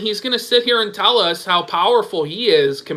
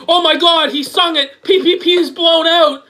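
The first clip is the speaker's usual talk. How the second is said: higher-pitched mock amazement; mock gushing; 8 semitones higher, 3 dB louder than usual. Host mimicking viewers awed by a dramatic song, mock excitement. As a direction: gush as if imitating an overawed fan.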